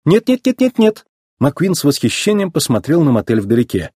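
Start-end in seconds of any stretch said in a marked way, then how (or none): 0.1-1.0 high, rapid-fire denials; hasty insistence. Acted. Racer rushes to accept the motel instead, hasty insistence. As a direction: jump in eagerly to refuse. Hurriedly declines staying at impound.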